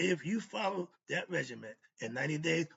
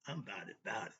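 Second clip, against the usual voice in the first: a lightly voiced, lower Master P catchphrase; 7 semitones lower, 5 dB quieter, faster than usual. Vlogger quoting his rapper catchphrase, lightly imitated. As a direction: toss off a low, laid-back Master P line. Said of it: "in my Master P voice".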